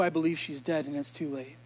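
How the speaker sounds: low, soft and defeated; defeated, hopeless. Shown as a demonstration. Preacher showing the congregation a voice of despair, low and defeated. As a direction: let the hope drain out of it.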